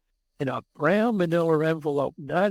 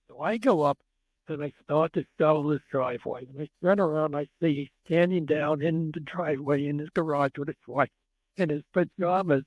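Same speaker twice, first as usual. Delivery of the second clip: usual speaking voice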